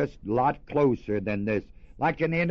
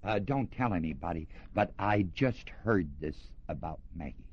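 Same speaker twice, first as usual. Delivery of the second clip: quiet, confiding gossip voice; hushed gossip; 6 semitones lower, 6 dB quieter than usual. Retold or imitated. Church gossip passing on a rumour, hushed. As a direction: lean in and pass on a secret. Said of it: "how people talk about people".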